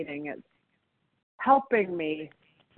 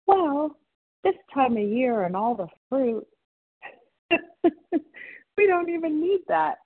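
much higher-pitched quote with a laugh; nice young guy; 11 semitones higher, 4 dB louder than usual. Retold or imitated. Cashier consoling a sorry-for-herself shopper, high-pitched imitation. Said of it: "this young, nice guy says".

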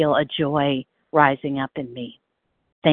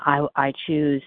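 ordinary delivery

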